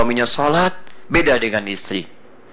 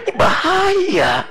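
mock wail; much slower than usual.

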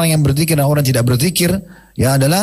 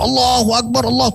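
high, stretched takbir at everyday volume; mock shouting; 9 semitones higher than usual. Companions cry takbir on seeing Khaybar's forts, mock shouting. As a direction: imitate a crowd's cry, pitched well up. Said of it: "teriak-teriak".

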